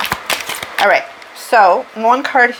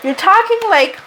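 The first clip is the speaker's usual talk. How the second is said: playful high-pitched disbelief; mock surprised self-talk; 11 semitones higher than usual. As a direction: pitch jumps way up on "You're talking like". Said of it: "I'm listening to myself talking and I'm like".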